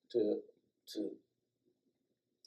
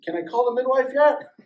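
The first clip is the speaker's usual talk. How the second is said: urgent question; 12 semitones higher, 12 dB louder than usual. Retold or imitated. Wife in labor asks urgently.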